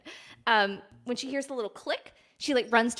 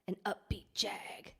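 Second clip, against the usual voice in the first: breathy whisper, slower; 4 semitones lower, 13 dB quieter, slower than usual. Shown as a demonstration. Streamer repeating her own phrase to chat, slow whisper. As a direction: hush it right down to a whisper. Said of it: "I don't know why I just said it like that".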